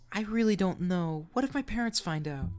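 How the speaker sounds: put-on high, unsure teen girl; a nervous girl hesitating; timid young girl voice. Young girl texting an older man, high timid voice.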